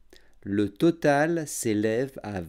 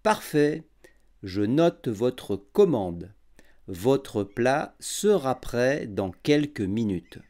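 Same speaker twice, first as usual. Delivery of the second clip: every syllable clear and unhurried; over enunciating; 5 semitones lower than usual. Shown as a demonstration. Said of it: "j'articulerai bien et je parlerai lentement".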